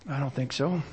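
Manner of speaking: low, hushed answer; quiet denial. Real, not acted. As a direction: drop low and soft to dismiss the idea. Quietly answers his own question.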